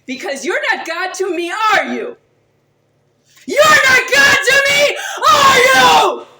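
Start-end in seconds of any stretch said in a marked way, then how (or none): 3.5-6.3 screamed much higher and much louder, voice cracking toward a cry; screaming the same challenge again at full force; tearful all-out shriek at the screen; real furious scream. Real, not acted. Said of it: "tell them I shouted".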